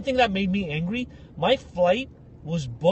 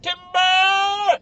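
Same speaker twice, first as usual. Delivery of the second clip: high, drawn-out mock lumberjack call; longest timber call; 10 semitones higher, 4 dB louder than usual. Mocking the big guy's slow fall, long timber call. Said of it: "it's the longest timber you ever heard".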